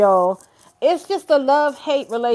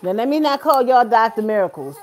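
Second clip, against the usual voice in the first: ordinary talk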